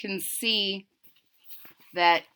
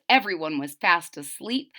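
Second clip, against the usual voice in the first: ordinary delivery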